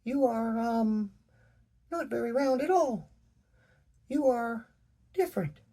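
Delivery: put-on very high, bright childlike voice with a tremble; a small rock girl greeting a giant in awe; little girl Pebbles. A narrator's reading. Child speaking up to a giant stranger, high and trembling. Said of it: "Pebbles' sweet voice revealed her innocence".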